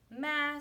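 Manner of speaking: high bleating ma; goat bleat. A narrator's reading. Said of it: "said Goat".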